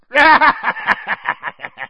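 high, loud manic cackle; long crazed cackle, trailing down; maniacal laugh. A narrator's reading. Uncle triumphing over Harry, maniacal laugh. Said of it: "laughing like a maniac".

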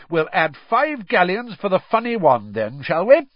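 high, booming enthusiasm; jovial bookmaker in full flow; excited. A narrator's reading. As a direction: talk the bets up with gusto. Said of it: "rattling his pockets excitedly".

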